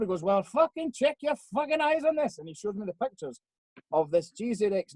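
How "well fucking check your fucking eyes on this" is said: high, put-on Welsh accent; mock Welsh accent. Max showing off pictures of the car, mock Welsh accent. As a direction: imitate him in a lilting fake Welsh voice. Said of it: "so I'll do a Welsh one instead".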